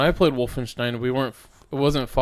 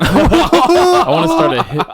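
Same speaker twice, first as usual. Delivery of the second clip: high squeaky laughing voice; 12 dB louder, slower than usual. Pretend. Repeats joke laughing, squeaky.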